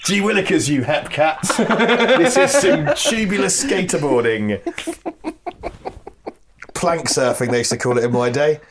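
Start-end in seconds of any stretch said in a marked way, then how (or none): 0.0-4.6 put-on very high, slow old-timey kid voice; squeaky gee-whiz kid, laughing through it; wholesome 1950s kid. Old-timey skater kid bit.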